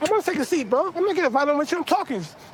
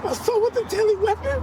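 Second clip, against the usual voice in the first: high, stunned question; can't believe the charge; shocked disbelief; 7 semitones higher than usual. Real, not acted. Hears his charges read out.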